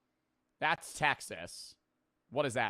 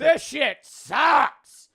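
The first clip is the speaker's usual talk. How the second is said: high, loud, drawn-out complaint; loud outburst; 13 semitones higher, 14 dB louder, much slower than usual. Real, not acted. Reacting to the five million flat, loud disgusted yell.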